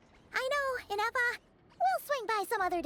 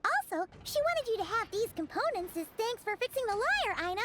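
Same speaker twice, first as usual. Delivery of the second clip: usual speaking voice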